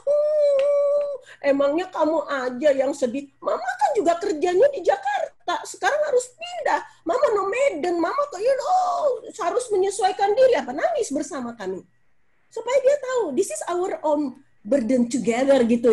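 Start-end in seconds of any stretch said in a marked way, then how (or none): 0.1-10.6 put-on high, tearful pleading to her child; crying mother. Mother crying with her son by the road, high tearful pleading. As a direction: sob it out to the child. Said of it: "duduk di pinggir jalan berdua nangis".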